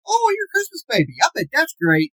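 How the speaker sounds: high sing-song teasing coo that drops back at the end; mock cutesy voice. Strangers teasing him about his birthday, high sing-song coo. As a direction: coo it with fake delight. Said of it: "Heard it my entire life".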